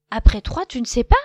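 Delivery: loud teasing question; mock him loudly. A narrator's reading. Lapin teasing Loup, loud and mocking.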